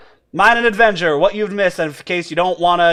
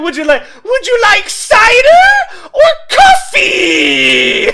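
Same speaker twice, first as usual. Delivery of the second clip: high, loud, slow mock barkeep; mock-operatic bartender offering drinks; hammed-up bartender bit; 9 dB louder, slower than usual. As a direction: belt it out like a silly bartender.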